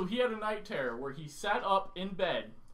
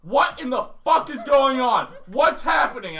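louder, higher, slowed panicked shout; panicked yell; 6 semitones higher, 11 dB louder than usual. Retold or imitated. Startled roommate yelling into the dark room, panicked shout. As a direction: demand answers in a frightened yell.

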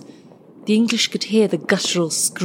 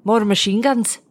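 mild narrated yell, pitched up a little; raised-voice order, lightly acted; yelling to a comrade; 4 semitones higher than usual. A narrator's reading. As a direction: call it across to your mate. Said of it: "Bill yelled to Tom".

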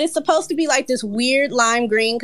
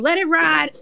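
higher-pitched mild mock yell; retold yell; 8 semitones higher than usual. Artist retells calling across the room to a neighboring booth, mild yell. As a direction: re-enact calling out, without full volume. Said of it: "I just yelled out across the room".

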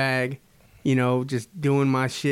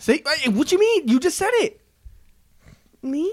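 high squeaky mock voice; 12 semitones higher than usual. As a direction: squeak it like a teen girl. Mocking high voice.

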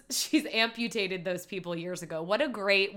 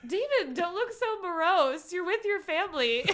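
Johnny voice; 9 semitones higher than usual. Retold or imitated. Quoting Johnny to David.

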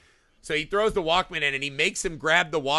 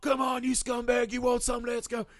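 higher taunting call-out; mock taunt; 8 semitones higher than usual. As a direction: egg him on, pitched up. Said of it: "she's like".

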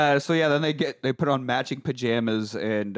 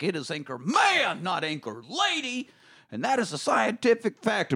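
imitated indignant movie outburst, pitch climbing; drawn-out, rising mock yell without much volume; 6 semitones higher, 3 dB quieter than usual. Retelling the newsroom yelling over the anchor job, rising mock yell. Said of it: "we have all the yelling".

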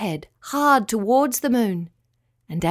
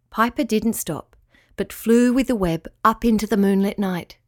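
usual speaking voice

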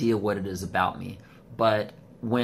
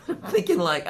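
laughing; 9 semitones higher, 4 dB louder than usual. Real, not acted. Laughs while retelling gym guy.

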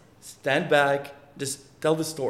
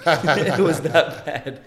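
laughing; 3 semitones higher, 8 dB louder, much slower than usual. Real, not acted. Laughing at own failure.

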